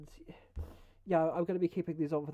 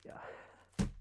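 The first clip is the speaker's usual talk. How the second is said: whispering; 8 dB quieter than usual. Shown as a demonstration. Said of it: "testing ASMR whisper".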